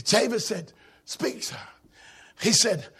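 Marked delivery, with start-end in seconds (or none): 1.0-1.6 breathless whisper, barely voiced; whispered command. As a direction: whisper it to the messenger.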